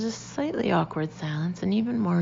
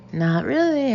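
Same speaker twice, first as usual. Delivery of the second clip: high, loud attempt at brightness; forced brightness; 8 semitones higher, 6 dB louder than usual. A narrator's reading. Becky reassuring Janice she doesn't fancy Tom, forced brightness. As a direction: answer as brightly as you can manage. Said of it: "as brightly as I can".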